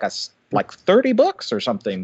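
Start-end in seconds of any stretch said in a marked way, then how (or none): 0.7-1.5 cracking voice, caught off guard. Real, not acted. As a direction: voice breaks upward with excitement. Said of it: "sorry, my voice cracked".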